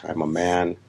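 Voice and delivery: ordinary talk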